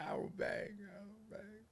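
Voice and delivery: whispered, terrified; scared whisper. Retold or imitated. Comic reliving telling the banger he doesn't bang, scared whisper. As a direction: barely breathe the words out.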